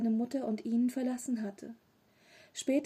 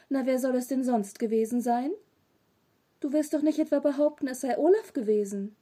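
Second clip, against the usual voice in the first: louder, reproachful questioning; cross scolding; 3 semitones higher, 6 dB louder than usual. A narrator's reading. Mother disbelieving her son's denial, angry scolding. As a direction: challenge the child's excuse, voice raised.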